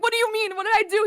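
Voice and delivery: put-on high, bewildered kid voice; high-pitched mock child protesting; little boy. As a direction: imitate a startled, innocent boy. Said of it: "this little boy's just like".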